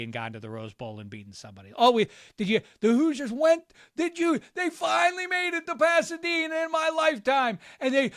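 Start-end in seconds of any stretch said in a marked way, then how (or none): 1.8-8.1 high, loud, breathless-with-news mock fan; gleeful fan. Imitating Hoosiers fans after a Rose Bowl win, excited. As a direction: gush like an overjoyed fan, pitched way up.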